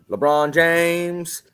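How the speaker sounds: Vine meme. Retold or imitated. Podcaster quoting an old Vine, slow hammed-up shout. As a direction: stretch it out like a Vine clip. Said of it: "old expired Vine".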